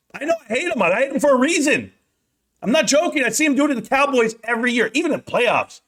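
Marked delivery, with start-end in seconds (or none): 0.1-4.4 worked-up rant. Real, not acted. Ranting about Aaron Rodgers beating Cowboys.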